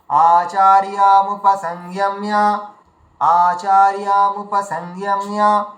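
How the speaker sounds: আচার্যামুপসংযম্য recited slowly in one joined flow; slow recitation. Shown as a demonstration. Teacher modeling a Gita line for learners, slow recitation. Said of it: "আলাদা আলাদা করে ভেঙে উচ্চারণ করবেন".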